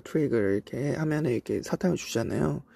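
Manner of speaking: usual speaking voice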